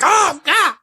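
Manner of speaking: short high-pitched mock outcry; crunk Jim Ross. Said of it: "Jim Ross got crunk".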